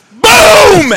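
huge high, shouted BOOM; loud imitated bang; mock gunshot boom. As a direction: explode on the word like a sudden gunshot.

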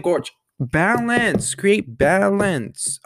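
balance, drawn out very slowly; slow, deliberate advice. Retold or imitated. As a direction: spell it out slowly like a lesson. Her own reply to critics.

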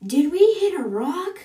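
loud, high-pitched yelled question; yelling. Acted. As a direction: yell it across the ship in alarm. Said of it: "And then Esther yells".

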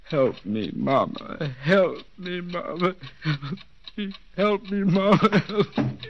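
rising, breaking pleas to Mama; desperate whimpering plea; pleading. Acted. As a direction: beg like a frightened child. Trapped man begging for mother.

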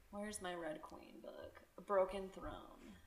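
very soft, slow, half-whispered murmur; muttering to oneself. Real, not acted. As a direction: murmur absently while searching for something. Muttering while hunting for the book.

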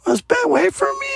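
put-on high, slow whiny little kid pleading; high kid voice begging mom to wait; crying toddler voice. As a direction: squeak it out like a tired toddler left behind. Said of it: "almost crying, like".

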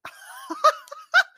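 high burst of laughter. Real, not acted. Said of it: "I love this scene".

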